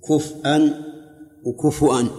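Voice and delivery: كفءا with a stop on the hamza, then وكفؤا with u before the hamza; other readings. Shown as a demonstration. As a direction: contrast كفءا and وكفؤا clearly. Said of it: "ثلاث قراءات".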